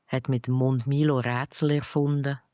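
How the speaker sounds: plain reading voice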